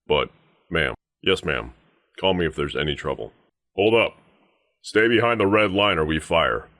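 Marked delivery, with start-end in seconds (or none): 3.8-6.7 high, firm guard's warning; stern threat, pitched up; warning challenge. Acted. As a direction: halt them with a raised voice. Guard warns visitor to halt.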